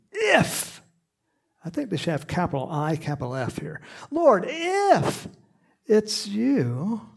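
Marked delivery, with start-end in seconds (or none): 0.1-0.8 squeaky Peter. Acted. Voicing Peter in the boat. 4.1-7.0 a high, nervous Peter voice; high timid Peter. Acted. Peter calling to Jesus on the water, high and timid.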